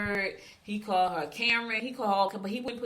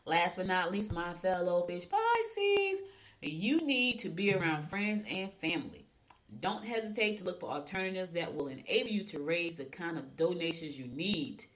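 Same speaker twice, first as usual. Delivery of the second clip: usual speaking voice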